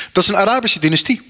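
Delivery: normal talking voice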